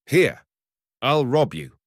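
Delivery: loud, high menacing threat. Acted. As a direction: bark the threat. Hunter threatens to rob.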